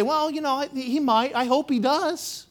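high, unsure mock hedging; doubtful hedge. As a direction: play Paul as wishy-washy and unsure. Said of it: "He didn't say".

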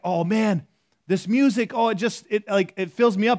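mock rapture over a new song; imitating a listener hooked on a song, gushing; gushing over music. Imitating someone loving new music.

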